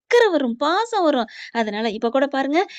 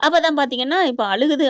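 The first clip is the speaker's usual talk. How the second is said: ordinary delivery, nothing marked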